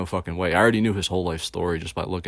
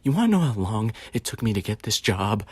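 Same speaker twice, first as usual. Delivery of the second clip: imitated trembling, unsettling voice; Joker voice; 3 semitones higher than usual. Coworker confiding about his job hunt, Joker-like trembling voice. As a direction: put on an eerie, shaken coworker's voice. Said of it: "he's talking like the fucking Joker".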